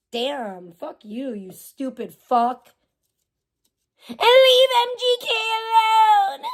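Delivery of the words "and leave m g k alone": high, slow mocking whine; sarcastic high-pitched plea. Mocks a fan's plea.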